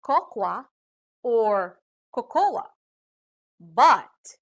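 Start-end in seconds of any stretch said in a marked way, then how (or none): 0.0-0.7 cocoa as KOK-wa; cocoa misread as kok-wah, slight rise; mispronounced word. Shown as a demonstration. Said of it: "We usually hear people say". 2.1-2.7 cocoa as ko-KO-la, three syllables; cocoa misread with an extra la ending; mispronounced word. Shown as a demonstration. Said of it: "We usually hear people say".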